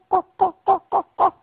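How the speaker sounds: cacareo agudo; cacareo de gallina. Shown as a demonstration. Imita a la gallina. (juego de sonidos para niños, cacareo de gallina)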